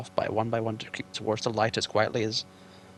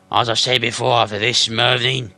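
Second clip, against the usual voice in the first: troll Bert; 10 dB louder than usual. Acted. Troll quarrel.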